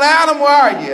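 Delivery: high, loud calling out; God calling out for Adam; hollered. Acted. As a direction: call across the garden. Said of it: "hollered out".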